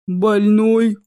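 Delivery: loud, booming stone troll. Acted. Troll puzzled by Ches rushing about, booming.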